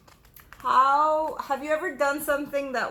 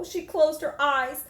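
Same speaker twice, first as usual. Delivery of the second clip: ordinary delivery, nothing marked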